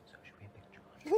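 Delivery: high unvoiced whisper; mock conspiratorial whisper; secret whisper. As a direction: slip the question in secretly. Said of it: "I almost wanted to be like".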